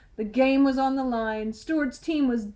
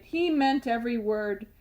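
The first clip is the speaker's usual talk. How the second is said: ordinary delivery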